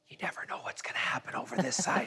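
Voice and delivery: hushed stage whisper aside. Real, not acted. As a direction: whisper it like a secret tip. Conspiratorial aside to captain.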